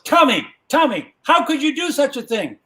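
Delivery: high-pitched, scandalised scolding; appalled father. Acted. Daddy catching Tommy with Grandmama, appalled father. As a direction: gasp it out as a shocked dad, pitched high.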